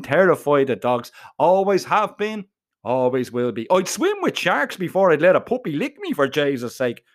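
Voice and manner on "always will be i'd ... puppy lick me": plain reading voice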